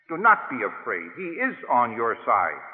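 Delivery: ordinary talk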